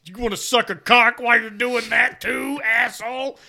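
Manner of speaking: high, loud jeering shout; bully someone for playing it safe; mocking taunt. Mocker jeering at seatbelt wearer, loud high taunt.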